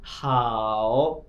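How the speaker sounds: हाओ stretched very long, dipping then rising; third tone demo. Shown as a demonstration. Teacher demonstrates the tone on हाओ, very drawn out. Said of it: "इकट्ठा करेंगे ओ को मिलाकर".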